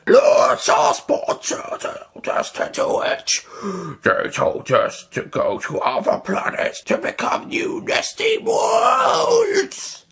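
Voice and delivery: high, slow wailing creature; liquid alien wail. Acted. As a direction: rise into a crying shout. Liquid Nestine voice.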